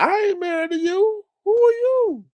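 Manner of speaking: very high, put-on sassy retort; mock high retort. Answering Bree about donations, high sassy retort. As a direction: fire it back in a high sassy voice. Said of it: "I was like".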